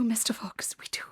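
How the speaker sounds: fearful unvoiced whisper. Mother fox whispering her fears to her husband. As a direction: whisper it, afraid for the children.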